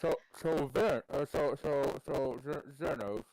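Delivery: talking through a mouthful, laughing; words round a bite of food; mouth full. Real, not acted. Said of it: "it's weird to talk with your mouth full".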